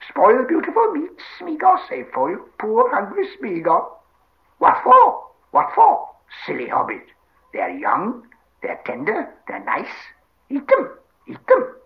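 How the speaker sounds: squealing creature voice, dipping then screeching; dismayed Gollum. Acted. Gollum pleads for raw rabbits, dismayed squeal. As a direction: plead and protest in a high wail. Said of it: "squealed Gollum in dismay".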